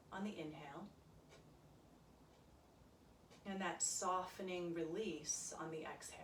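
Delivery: soft, calm yoga meditation voice; quiet guided meditation for a yoga class; guided relaxation. Acted. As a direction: ease them into their breath gently.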